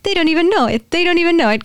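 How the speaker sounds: high, amused, knowing voice; amused Jesus quote. Retold or imitated. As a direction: mimic someone chuckling that they don't know. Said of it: "he's laughing 'cause he's like".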